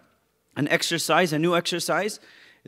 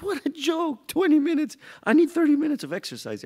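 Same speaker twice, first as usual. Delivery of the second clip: scoffing; 11 semitones higher than usual. Retold or imitated. Mocking the gym time limit.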